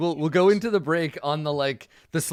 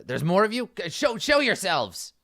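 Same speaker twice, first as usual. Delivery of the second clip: raised, higher villain's demand; commanding villain; 6 semitones higher, 3 dB louder than usual. Acted. Villain calling hidden companions to show themselves, commanding.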